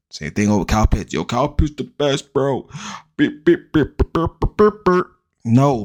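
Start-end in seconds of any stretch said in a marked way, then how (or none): 1.1-2.7 high-pitched parody of a gushing fan; pitched-up mock hype. Mimics hype about Kyle Pitts. 3.2-5.1 high staccato beeps, climbing higher; cartoonish high-pitched beep-beep chatter; mock beeping noise. Imitated noise mocking the hype.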